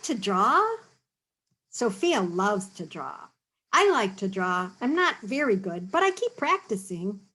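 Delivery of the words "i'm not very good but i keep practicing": ordinary delivery, nothing marked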